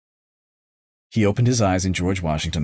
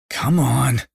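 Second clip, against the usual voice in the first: nervous; 9 semitones higher, 4 dB louder than usual. A narrator's reading. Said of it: "he said nervously".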